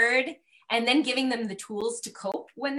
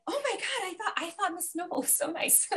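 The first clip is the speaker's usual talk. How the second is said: much higher, softer mock disbelief; shocked students; 9 semitones higher, 6 dB quieter than usual. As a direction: gasp it like a stunned tenth grader. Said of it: "So we're all like".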